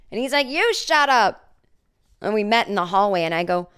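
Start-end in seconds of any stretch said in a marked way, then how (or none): 0.5-1.5 slow, high mock retort; neighbor yelling back. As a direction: throw it back at her slowly.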